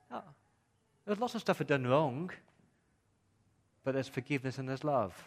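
usual speaking voice

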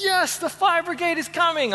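high, gleeful cheer; excited. Retold or imitated. Man thrilled the fire brigade is on its way, high and gleeful. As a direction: celebrate as if it's the best news ever. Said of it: "I was so excited".

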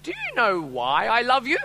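high, loud, drawn-out child impression; slow singsong question, playing a little girl; little daughter's voice. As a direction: imitate a child sweet-talking Dad. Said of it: "I hear this little voice in the back".

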